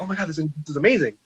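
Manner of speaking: fast, high, thrilled rush; reading the letter out in a fast, high thrill; excited gasp. Retold or imitated. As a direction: gush like a kid who just got big news.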